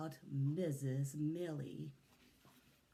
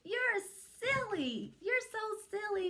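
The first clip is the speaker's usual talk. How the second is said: put-on high childish protest; children's cry; 4 dB louder than usual. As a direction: cry out like giggling schoolkids. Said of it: "we cried".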